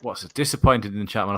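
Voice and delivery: ordinary talk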